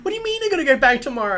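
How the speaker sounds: put-on high fast voice of a kid protesting school; complaining kid. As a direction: protest like an outraged child.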